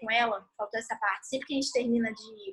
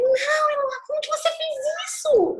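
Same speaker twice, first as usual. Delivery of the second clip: high-pitched mock scream, much louder; re-enacted angry yell; 14 semitones higher, 4 dB louder than usual. Mother re-enacts screaming at daughter over the mess, high angry yell. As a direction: act out losing your temper, shouting high. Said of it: "Eu gritei assim com ela".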